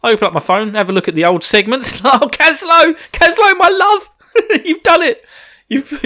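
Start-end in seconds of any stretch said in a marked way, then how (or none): 2.0-6.0 high, loud, laughing delight; excited. Real, not acted. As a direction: gush with laughing joy. Delighted thanks to friend.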